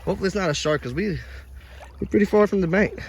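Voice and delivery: ordinary talk, nothing marked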